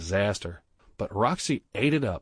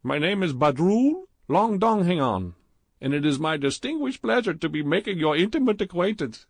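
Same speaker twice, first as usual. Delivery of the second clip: put-on higher, louder, heavy Indian accent; thick Indian accent; 9 semitones higher, 4 dB louder than usual. A narrator's reading. Clerk Badrul flirting with Stephen in the jacuzzi, thick Indian accent.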